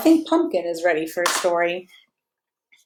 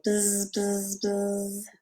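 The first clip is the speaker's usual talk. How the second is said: bee buzz; 9 dB quieter, much slower than usual. Shown as a demonstration. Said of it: "And bees go".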